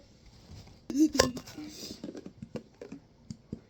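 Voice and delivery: breathy burst of laughter, trailing on; helpless laugh at someone's mishap; laughing at dad. Real, not acted. Laughing at dad burning his hand, breathy laughter. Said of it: "I was laughing at my dad".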